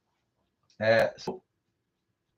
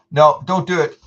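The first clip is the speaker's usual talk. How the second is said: loud no; 4 semitones higher, 9 dB louder than usual. Real, not acted. Telling viewer not to.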